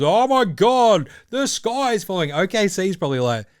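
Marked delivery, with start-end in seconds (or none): 0.0-2.3 hammed-up panicky wail, slow and higher; mock the fans who think the world is ending. Mocking overreacting fans, slow higher panic.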